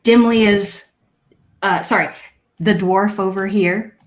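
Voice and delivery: ordinary talk, nothing marked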